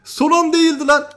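high-pitched, heated outburst; agitated protest. Real, not acted. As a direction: cry out in protest at the game. Game mishap, exclaiming in protest.

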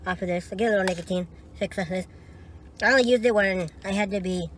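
talking around lip pouch; slight lip bulge speech; snus pouch in lip. Real, not acted. Seen 1: Placed after tin at 0.6; lip bulge, later points at gum.

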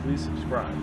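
normal talking voice

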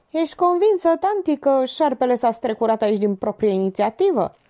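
usual speaking voice

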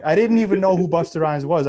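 ordinary talk, nothing marked

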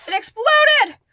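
high, loud startled cry; startled yell. Real, not acted. Unboxer as the album spills open, startled yell. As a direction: yell out in real surprise.

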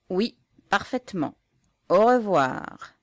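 slow, loud, drawn-out mock farewell; officer mockingly echoing the farewell; ironic goodbye. A narrator's reading. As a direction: stretch the goodbye out sarcastically. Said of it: "déclara l'officier avec ironie".

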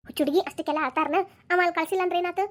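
normal talking voice

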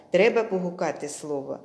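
normal talking voice

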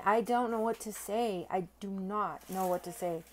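ordinary talk, nothing marked

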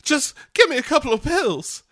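very high, hammed-up pleading starlet. Cat as struggling starlet asking for pills, mock desperate. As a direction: plead in a put-on showbiz squeal. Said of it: "that [name] impression".

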